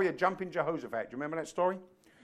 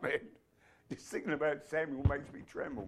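usual speaking voice

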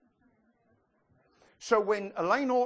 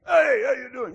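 loud, high hey across the store; hearty greeting; 7 semitones higher, 9 dB louder than usual. Retold or imitated. Lowe's worker hails the pastor, loud and hearty. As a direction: call out a hearty hello.

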